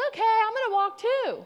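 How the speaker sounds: high, loud, enthusiastic imitation; eager friendly guy. Young man inviting himself along, loudly eager. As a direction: announce it with hammed-up eagerness. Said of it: "He goes".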